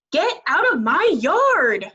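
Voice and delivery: high, loud and slow; shouted. A narrator's reading. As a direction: chase the pig off. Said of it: "shouted Eugenia".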